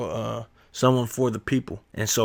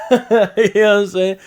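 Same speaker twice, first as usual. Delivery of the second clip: high, loud laughing through the question; 10 semitones higher, 8 dB louder than usual. Real, not acted. Laughing about aunties drinking.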